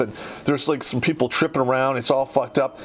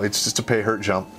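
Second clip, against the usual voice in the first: ordinary talk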